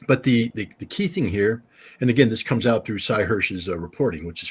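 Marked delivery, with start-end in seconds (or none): none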